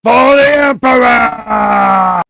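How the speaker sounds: slow, loud war cry; battle cry. Pretend. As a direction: roar it like a zealot charging.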